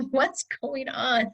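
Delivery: mildly voicing the sleepy husband's question; lightly voiced worried husband. Husband voiced in retold scene.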